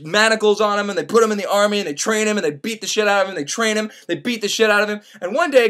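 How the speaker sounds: normal talking voice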